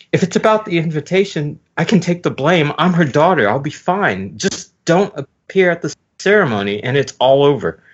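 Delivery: put-on high-pitched sweet girl voice; high girly voice. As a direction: lift into a girlish falsetto. Pudding voiced by male reader.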